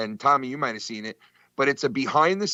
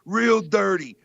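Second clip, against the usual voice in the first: imitating Dice; 6 semitones higher, slower than usual. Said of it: "he goes".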